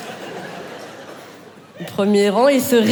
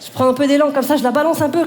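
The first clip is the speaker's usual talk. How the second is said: usual speaking voice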